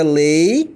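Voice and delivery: "lei-te" split into two beats, slow; syllables split. Shown as a demonstration. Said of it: "a gente pronuncia". ("lei-te" dividido em duas sílabas, devagar)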